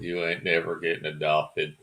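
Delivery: a hint of a cruel matron crushing an orphan's hope; cold orphanage matron. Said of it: "sounds like the woman telling an orphan".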